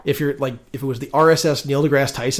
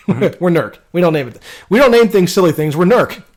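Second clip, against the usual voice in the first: hammed-up stuffy official, louder and higher; pompous indignant; 6 semitones higher, 8 dB louder than usual. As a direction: puff up and insist you're NERC. Mocking council's pompous refusal.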